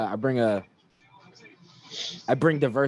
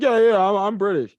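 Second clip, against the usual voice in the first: louder, high-pitched mocking quote; mock eager claim; 6 semitones higher, 5 dB louder than usual. Comedian mimics people claiming to be British, mock eager. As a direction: imitate someone eagerly claiming roots, high and loud.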